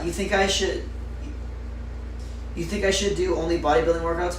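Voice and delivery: normal talking voice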